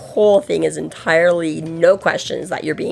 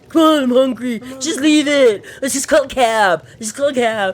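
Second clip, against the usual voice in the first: higher, lightly voiced bratty man nagging; whiny juvenile Bobby; 8 semitones higher than usual. Co-star Bobby pestering Emma to leave the set, mock whiny. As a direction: act out a bored man-child nagging to leave. Podcaster acting out Bobby's whining.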